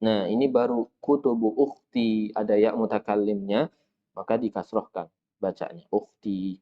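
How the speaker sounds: slow, careful Arabic reading for students; slow drill reading. Shown as a demonstration. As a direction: read each sentence slowly and clearly for the class. Reads drill sentences. (membaca kalimat latihan pelan-pelan)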